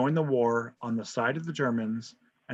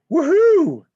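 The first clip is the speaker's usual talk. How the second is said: high, loud mock victory whoop; mock cheer; 12 dB louder than usual. Teacher cheering America's late war entry, loud high whoop. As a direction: whoop like a sports fan.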